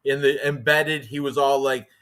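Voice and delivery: ordinary talk, nothing marked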